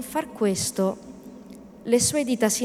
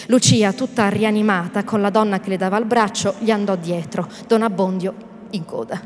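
usual speaking voice

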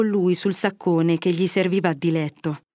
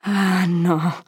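sighing. A narrator's reading. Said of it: "rispose Fermo con un sospiro".

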